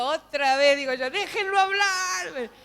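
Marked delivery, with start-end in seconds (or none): 1.1-2.5 loud, high, drawn-out plea; acted protest. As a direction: call out slowly to let him speak. Acts out her protest at the video.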